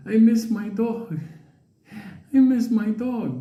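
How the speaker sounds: put-on high, drawn-out old lady voice; imitating a wistful old woman; plaintive old lady. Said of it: "this old lady".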